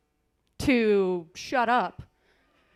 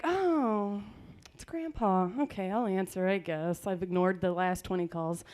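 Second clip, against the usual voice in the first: lower, softer reluctant grumble; grudging mutter; 6 semitones lower, 6 dB quieter than usual. Retold or imitated. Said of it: "I'm like".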